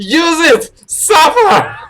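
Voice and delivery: loud high yell; shouting. Acted. Shouted outburst.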